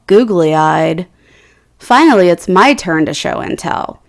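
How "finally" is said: ordinary delivery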